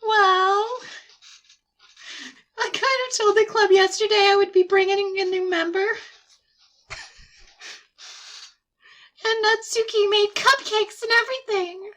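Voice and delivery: high, put-on voice of a sheepish girl; sheepish girlish friend. Sayori confesses to her friend, high and sheepish.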